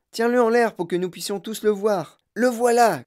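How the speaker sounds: high, excited onlooker's shout; crying out. A narrator's reading. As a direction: call out over the crowd. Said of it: "cria une autre voix".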